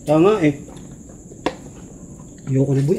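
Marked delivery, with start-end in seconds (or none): none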